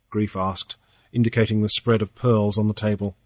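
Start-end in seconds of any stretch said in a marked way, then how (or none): none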